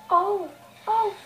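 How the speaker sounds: ordinary talk, nothing marked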